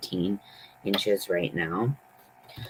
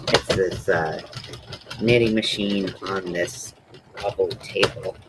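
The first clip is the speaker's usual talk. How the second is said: normal talking voice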